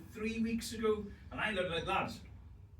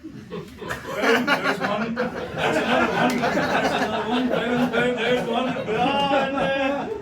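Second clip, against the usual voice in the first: loud gleeful calling out, higher; laughing callout; 6 semitones higher, 11 dB louder, much slower than usual. Real, not acted. Comic points at women in the crowd, laughing loudly. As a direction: spot them in the crowd and crow, laughing.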